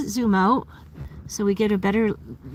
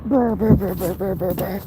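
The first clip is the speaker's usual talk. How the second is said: lips-trembling brr sound; shivering brr. Real, not acted. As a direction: brr from the cold. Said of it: "I'm too cold".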